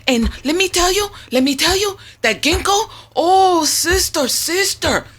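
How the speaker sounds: high, loud hammed-up Jamaican lilt; Jamaican accent. Retelling the Jamaican caller's husband story, hammed-up Jamaican accent. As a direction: play the laughing island lady, way up high. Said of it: "You're not bad with Jamaican Creole".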